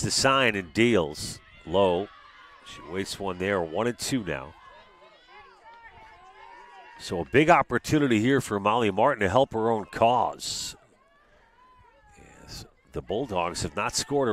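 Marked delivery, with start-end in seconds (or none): none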